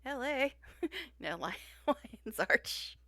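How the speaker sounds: laughing through the correction; giggling, slow self-correction. Real, not acted. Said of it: "L A is just easier to say".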